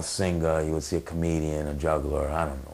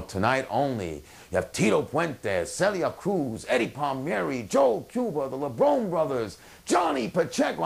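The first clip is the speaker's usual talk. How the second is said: climbing higher name by name; excited billing; 7 semitones higher than usual. Retold or imitated. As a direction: read out an all-star bill, building excitement. Reads out a star-studded marquee bill.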